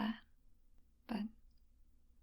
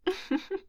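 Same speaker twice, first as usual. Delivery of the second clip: bright teasing giggle; playful laugh; 10 semitones higher than usual. Acted. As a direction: laugh off the act.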